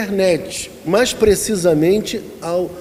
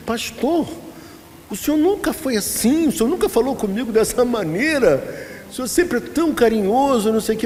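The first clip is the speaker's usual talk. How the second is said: higher, lightly voiced offended sister; hurt church sister; 6 semitones higher than usual. Pastor imitating the sister complaining he was rude, mildly hurt. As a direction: mimic her wounded complaint, pitched up. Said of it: "ela virou-se pra mim e falou assim".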